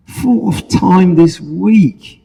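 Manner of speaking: high, slow, tired genie; weary genie. Acted. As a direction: sigh it out like a worn-out genie. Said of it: "a weary genie".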